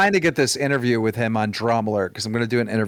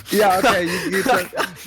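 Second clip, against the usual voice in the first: mock eager yes; 8 semitones higher than usual. Humoring drunk friend Leafy about his ten percent, mock eager. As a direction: play along like you're humoring him.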